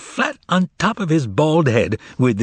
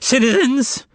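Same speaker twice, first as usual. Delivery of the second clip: high, thin call to the crowd; thin, tremulous shout; 12 semitones higher than usual. A narrator's reading. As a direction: quaver it to the crowd. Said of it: "he shouted in a thin, tremulous voice".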